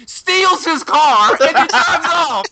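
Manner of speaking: loud, high, breaking into laughter; high-pitched comic outrage tipping into laughter; incredulous laughing retelling. Real, not acted. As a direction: crow the punchline, stretched out, then crack up. Cracking up at his own retelling.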